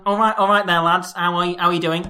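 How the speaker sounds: lightly voiced Cockney greeting; a hint of the geezer voice; Guy Ritchie Cockney. Host greeting the lads as Guy Ritchie, light Cockney. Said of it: "I'm all right, Guy Ritchie".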